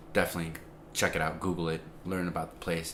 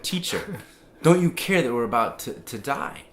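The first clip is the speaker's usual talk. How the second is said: lightly acted higher-pitched plea; a touch of alarm, voice pitched up; mock alarm; 5 semitones higher, 6 dB louder than usual. Disciples waking Jesus in the storm, mildly alarmed.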